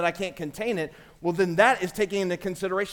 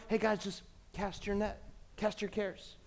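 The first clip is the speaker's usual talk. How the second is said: offhand, softer, throwaway advice; flippant; 12 dB quieter than usual. Shown as a demonstration. Pastor mocking a careless church saying, flippant and soft. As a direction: toss it off like it's nothing. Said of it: "I don't think he's saying".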